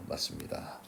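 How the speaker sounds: breathy whisper, no voice; whispered aside. Real, not acted. Teacher confirming the answer to students, whispered.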